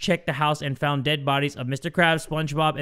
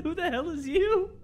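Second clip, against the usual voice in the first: mock squeaky repeat; 11 semitones higher, 6 dB quieter than usual. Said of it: "I said".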